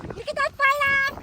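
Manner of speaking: high, drawn-out loud call; urgent shout. Acted. Woman tells the victim to flee the ghost, urgent shout. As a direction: scream at someone to run.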